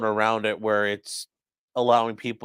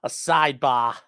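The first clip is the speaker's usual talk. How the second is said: lightly imitated Massachusetts accent; 3 semitones higher than usual. Mimicking the attorney asking for a sidebar, Massachusetts accent. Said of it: "that's a real Massachusetts accent".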